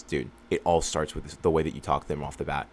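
ordinary talk, nothing marked

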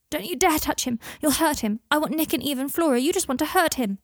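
put-on high, loud, upset child's protest; protective outburst. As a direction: cry out fiercely to protect your dad. Child defends father from Harry.

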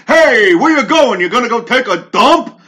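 loud, high jeering Dice voice; hammed-up heckle at a walking-out woman; mock heckling comic. Comic heckling a woman leaving the show, loud and jeering. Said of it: "He goes".

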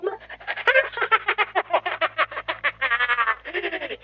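long triumphant villain cackle; evil cackle. Acted. Villain laughs after rant.

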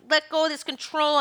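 high, pleading and fed up; an eager soul nagging the body to hurry; impatient soul. Acted. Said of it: "the soul's like".

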